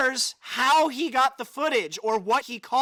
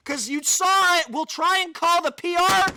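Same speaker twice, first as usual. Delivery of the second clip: loud, high taunting demand; mocking challenge; 7 semitones higher, 7 dB louder than usual. Real, not acted. Taunting the silent company on stream, loud and high. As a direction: call them out loudly.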